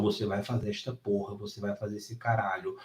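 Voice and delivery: low, soft, menacing push; intense low command. Retold or imitated. Trainer eye to eye urging student through the lift, low and hushed. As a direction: grit it out close to her face. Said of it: "olho no olho e falo".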